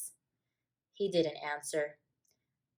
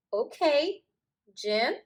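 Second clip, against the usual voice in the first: put-on high, louder asking boy; little boy; 7 semitones higher, 5 dB louder, slower than usual. A narrator's reading. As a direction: ask your friend. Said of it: "asked Paul".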